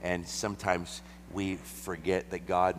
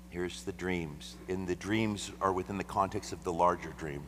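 normal talking voice

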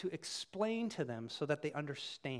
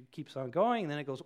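usual speaking voice

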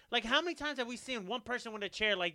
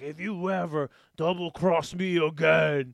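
slow, loud threatening impression; mock wrestler's menace, drawn out; imitating Strowman's threat; 3 semitones lower, 7 dB louder, slower than usual. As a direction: threaten slowly and loudly, like a wrestler. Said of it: "did you hear what Strowman said".

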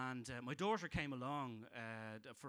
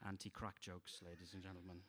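very low and much softer; deadpan aside; 8 semitones lower, 11 dB quieter than usual. Acted. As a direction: drop it flat and quiet. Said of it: "deadpan catchphrase after jokes".

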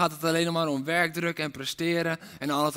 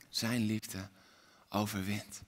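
much softer and lower; hushed; 7 semitones lower, 7 dB quieter than usual. Acted. Preacher closing the refrain, hushed. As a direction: let it land softly.

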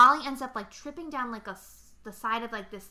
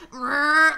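drill noise; 5 semitones higher, 12 dB louder than usual. Retold or imitated. Imitating power drill.

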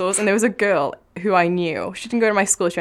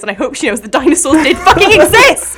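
emphatic; 5 semitones higher, 9 dB louder than usual. Real, not acted. Emphatic declaration.

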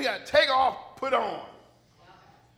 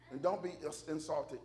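ordinary talk, nothing marked

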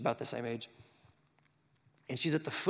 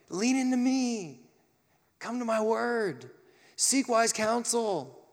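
God calling out; 9 semitones higher, 4 dB louder, slower than usual. Acted.

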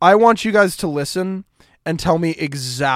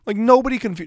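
normal talking voice

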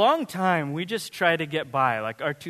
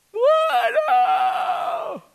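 put-on outraged child; 6 dB louder than usual. Kid protesting dad's order.

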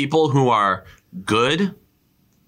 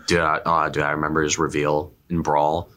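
normal talking voice

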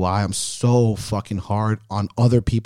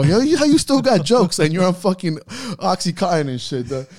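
high, excited teasing; high-pitched laughing challenge to dad; amused disbelief; 8 semitones higher than usual. Retold or imitated. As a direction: rib him in delighted disbelief, high. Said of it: "I go".

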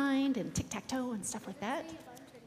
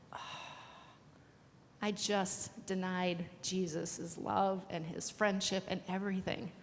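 slow, softer, sinking regret; dejected realization; 3 semitones lower, 7 dB quieter than usual. A narrator's reading. Pastor voices Peter hearing the rooster, dejected. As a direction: let it sink in slowly and quietly.

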